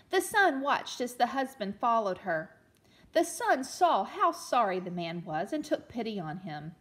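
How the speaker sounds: put-on storytelling read-aloud for children; gentle read-aloud storyteller; story narration. A narrator's reading. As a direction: read it like a picture book to a class.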